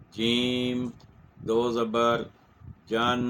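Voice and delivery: جیم, دو زبر, جن in separate beats, ending on a clear nasal n; spelling out tanween. Shown as a demonstration. A Quran teacher drills students, part by part. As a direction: spell each part apart. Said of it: "تو ہم کہیں گے".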